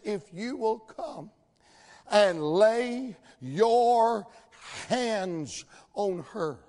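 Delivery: very slow, stretching "lay your hands on her"; deliberate emphasis. Retold or imitated. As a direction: draw out each word of the plea. Statement repeated for emphasis.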